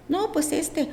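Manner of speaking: voz de la doctora, mucho más aguda; imitating the doctor. Doctor answers on phone. (la ginecóloga le responde por teléfono, voz aguda imitada)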